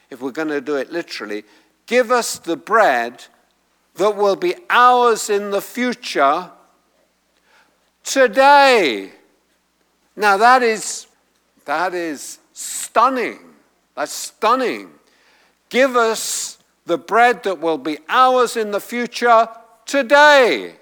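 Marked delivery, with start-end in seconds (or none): 1.8-9.1 pause, then "today" loud and high; emphatic final TODAY. Shown as a demonstration. Preacher putting the Greek emphatic last, loud high "today". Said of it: "the emphatic is at the end". 15.7-20.7 high, loud line peaking on "today"; emphatic final TODAY. Shown as a demonstration. Preacher repeating the literal translation, emphatic high "today". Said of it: "That's the emphatic".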